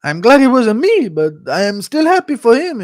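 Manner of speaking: high, put-on voice for a fighter; quoted fighter impression. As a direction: mimic him bravely claiming to be happy. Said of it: "he was saying, like, uh".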